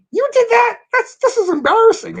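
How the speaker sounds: high, loud mortified exclamation; embarrassed disbelief. Retold or imitated. Mortified in front of coworkers, high loud disbelief. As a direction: cry out as if caught red-handed. Retelling reaction to prank.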